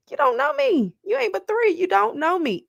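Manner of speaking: high-pitched indignant comeback; mock indignant retort. Parent talking back to a staring three-year-old, mock indignant. Acting out her startled retort to child.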